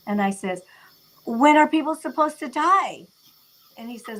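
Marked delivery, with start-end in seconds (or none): 1.2-3.1 raised, louder exasperated question; retold incredulous protest; indignant challenge. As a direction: demand it as if the rule is absurd. Retold challenge to after-hours fee.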